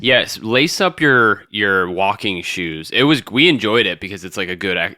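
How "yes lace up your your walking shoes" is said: slow emphatic. Acted. Dramatic hiking advice.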